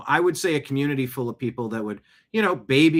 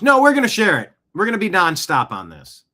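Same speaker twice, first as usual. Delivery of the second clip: higher, louder defiance; loud defiant refusal to a police request; 6 semitones higher, 6 dB louder than usual. Real, not acted. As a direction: shout down the order. Defying police request not to share.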